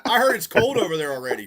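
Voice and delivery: usual speaking voice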